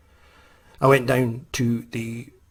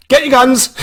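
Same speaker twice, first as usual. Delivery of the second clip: high, loud call to arms; mock alarm shout; 13 semitones higher, 9 dB louder than usual. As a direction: shout the order like a panicked ranger. Ranger raises the alarm, retold.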